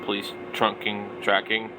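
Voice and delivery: normal talking voice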